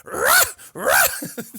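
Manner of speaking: high, loud dog barks; barking like a puppy. Retold or imitated. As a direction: bark like a puppy at full volume. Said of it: "Now bark like a puppy".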